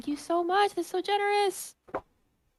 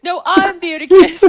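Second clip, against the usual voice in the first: laughing mock claim; 4 semitones higher, 10 dB louder than usual. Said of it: "the chat's going".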